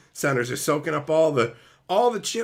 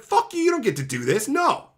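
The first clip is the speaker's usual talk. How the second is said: angry retort; 7 semitones higher than usual. Retold or imitated. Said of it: "And I'm like".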